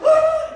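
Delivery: high pained scream; dramatic death scream. Pretend. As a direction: cry out as if shot. Kid dramatic death cry.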